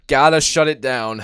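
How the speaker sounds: loud, fervent plea to shut it down; urgent shout. Real, not acted. Fan shouting at the TV for the closer to finish it. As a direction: yell it at the screen like a fan. Tense late innings, urging closer.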